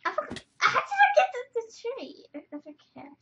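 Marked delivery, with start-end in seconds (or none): 0.6-2.2 surprised. Real, not acted. Surprised at game tree.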